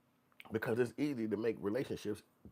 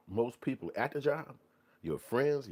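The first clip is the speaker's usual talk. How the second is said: usual speaking voice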